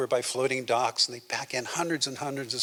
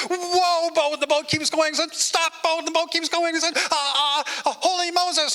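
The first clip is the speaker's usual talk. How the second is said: high, loud frantic cries; panicked shouts; 4 dB louder than usual. Acted. Man racing toward a dam begging the boat to stop, frantic high shouts.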